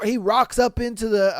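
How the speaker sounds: usual speaking voice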